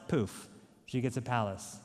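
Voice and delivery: ordinary talk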